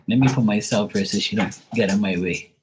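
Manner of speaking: ordinary talk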